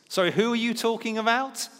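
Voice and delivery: high, mock-innocent question; feigned ignorance. Peter pretending not to know Jesus, high mock innocence. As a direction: play dumb, voice lifted high. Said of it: "he claims ignorance".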